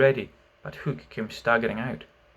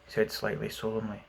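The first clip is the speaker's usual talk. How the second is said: usual speaking voice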